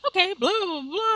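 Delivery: high-pitched mock cashier patter; imitated cashier. Retells cashier answering a long order.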